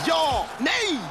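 high shouted yes then no; excited shout. Acted. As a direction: cry out as the goal is denied. Goal chance saved. (upphetsat rop)

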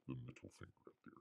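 fast, breathy whispered aside. Real, not acted. Host joking about the cover art to viewers, in a quick whisper.

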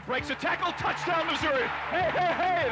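high excited play-by-play; touchdown call. Real, not acted. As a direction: shout it as he breaks free. Radio call of a touchdown run.

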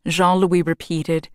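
ordinary delivery, nothing marked

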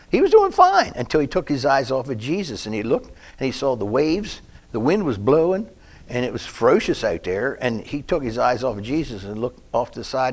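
loud, driving sermon delivery; building preacher's fervor; preaching at full force. Acted. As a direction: preach the sinking with rising fervor.

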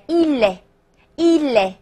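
"il" run into a following "est", slow, as "i-lè"; linked pronunciation. Shown as a demonstration. Teacher showing students he is, slow linked "il" as "i-lè". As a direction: slowly link "il" to the next vowel. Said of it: "连起来".

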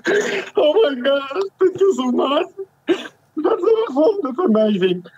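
high, teary voice breaking with joy; overwhelmed, crying with happiness; happy crying. Real, not acted. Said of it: "are you laughing or crying?".

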